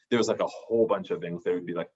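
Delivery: ordinary talk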